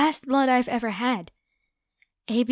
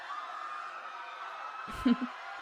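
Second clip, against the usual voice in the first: breathy gleeful cackle fading off; airy villain laugh; 10 dB quieter than usual. Acted. Vampire laughing alone after the general leaves. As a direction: laugh to yourself like a pleased predator.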